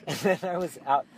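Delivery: normal talking voice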